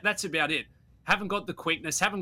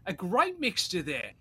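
excited raving; 8 semitones higher than usual. Real, not acted. Host raving about the clips.

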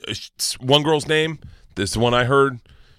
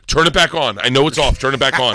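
louder firm command; stern order; 2 semitones higher, 5 dB louder than usual. Retold or imitated. Dad ordering his daughter to turn tracking back on, loud and firm. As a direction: lay down the law.

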